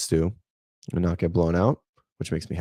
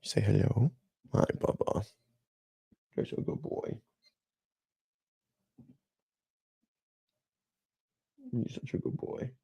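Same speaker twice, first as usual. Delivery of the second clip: affectionate pet talk; 5 semitones higher, 9 dB quieter than usual. Real, not acted. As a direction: coo quietly to a beloved dog. Fondly talking to pet dog.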